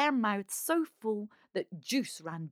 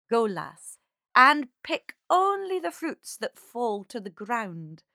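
booming kindly man; 3 semitones higher, 8 dB louder than usual. Acted. September speaks to girl.

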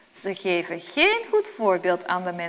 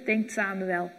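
usual speaking voice

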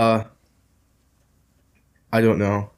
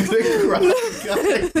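high laughing cry of disbelief; cracking up at a friend brandishing a knife, laughing; laughing exclamation; much slower than usual. Real, not acted. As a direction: blurt it out through laughter, pitched up.

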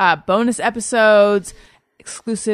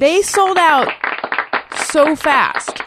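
higher, emphatic marveling; emphatic amazement; 7 semitones higher, much slower than usual. Real, not acted. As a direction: play up how amazing it is. Host marvels at quick sellout.

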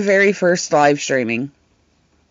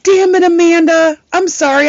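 high, louder sorry; mock apology; 9 semitones higher, 3 dB louder than usual. Streamer apologizing to Amanda, high and loud. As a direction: exclaim it up high.

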